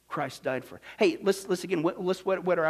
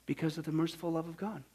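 ordinary talk, nothing marked

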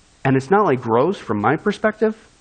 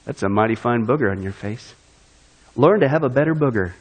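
mock flattering preacher; 8 semitones lower than usual.

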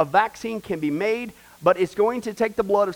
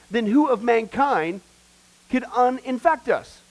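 fiery preaching; 4 semitones higher, 3 dB louder than usual. Acted. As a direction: drive it home from the pulpit, word by word. Builds to full preaching force.

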